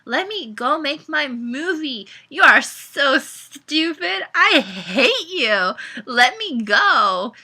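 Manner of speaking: high, loud, drawn-out protest; shouting. A narrator's reading. Mariana fighting Caleb's grip to get free, shouting. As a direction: stretch out each furious demand. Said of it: "shouted Mariana".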